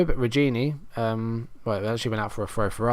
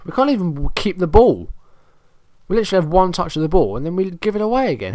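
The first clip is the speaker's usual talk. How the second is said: higher, louder frustrated rant; fast, higher exasperated complaint; 7 semitones higher, 4 dB louder than usual. Real, not acted. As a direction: vent at the useless team. Frustrated at his team.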